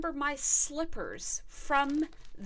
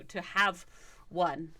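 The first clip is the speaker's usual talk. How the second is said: usual speaking voice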